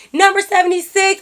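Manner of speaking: lightly imitated order caller; high, projected number call; server calling out. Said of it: "the lady like".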